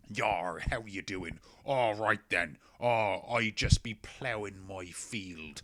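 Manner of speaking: mock Cornish pirate accent, slow and rolling. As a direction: ham up a West Country pirate. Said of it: "he used his natural Cornish accent".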